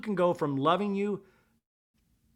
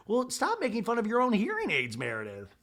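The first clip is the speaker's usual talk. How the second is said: ordinary delivery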